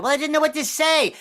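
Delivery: high-pitched, defensive mock voice; woman caller. Woman caller defending herself, high mock voice. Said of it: "I actually had a woman call me on the phone".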